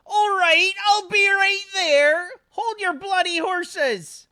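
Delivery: high, loud, slow mock-grumpy mum calling out; grumpy old mum. GM voicing Arthur's mum yelling at the clanging door, high and loud. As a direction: shout through the door like an irritated old mum.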